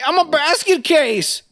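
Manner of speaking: high, slow, hammed-up punchline; waiter impression. Waiter's bread joke repeated, hammed-up high slow voice. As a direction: stretch it out in a comic high waiter voice. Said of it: "and he goes".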